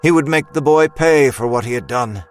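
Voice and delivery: ordinary delivery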